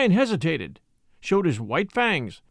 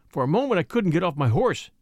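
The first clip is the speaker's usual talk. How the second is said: plain reading voice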